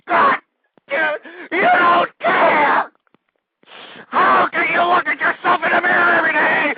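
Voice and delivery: high, shouting, some words slowed; enraged scolding. Real, not acted. Host berating listeners who don't care, shouting. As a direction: accuse them to their face.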